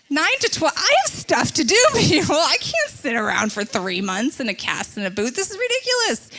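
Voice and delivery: high, loud comic disbelief; mock outrage at the doctor's news; indignant outburst. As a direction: protest loudly, voice shooting up. Acted protest at recovery time.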